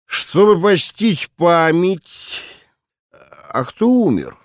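put-on slow, slurred drunk voice; drunken slur. A narrator's reading. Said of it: "начал он заплетающимся голосом". (пьяный заплетающийся голос)

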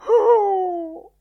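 loud, high wailing "oh"; drawn-out theatrical cry; noisy mock wail. A narrator's reading. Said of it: "pianse Lenehan con un certo rumore".

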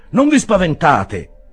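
loud, high, drawn-out call; insistent reassurance. A narrator's reading. Master of the house repeats himself to frightened ladies, insistent. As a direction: repeat it slowly and forcefully. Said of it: "ripeté il padrone di casa".

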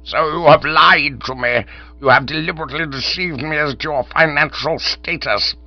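furious. Acted. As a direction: let the fury boil over at him. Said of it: "Old Chiswick foamed at the mouth".

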